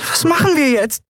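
tiny, very high voice; squeaked. Acted. Small companion asking the boy what now, alarmed squeak. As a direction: squeak it out, wide-eyed with alarm. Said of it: "piepste sie".

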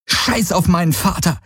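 high, heated outburst; angry interruption. Acted. He interrupts her about his father, angry interruption. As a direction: cut in sharply, pitch jumping up. Fällt ihr im Streit ins Wort.

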